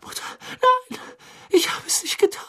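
hohe, flehende Jungenstimme, den Tränen nah; verzweifelter Junge. A narrator's reading. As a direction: fleh die Mutter in Panik an. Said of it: "Wieder begann der Junge zu schreien". (hoch und verzweifelt bettelnd)

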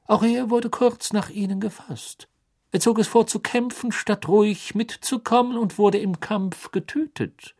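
strenger Beamter, hoch und laut; kühl fordernd verhören; Crouch. Acted. Crouch berichtet. (Crouch fordert Karkaroff streng zum Reden auf)

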